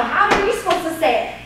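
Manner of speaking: ordinary talk, nothing marked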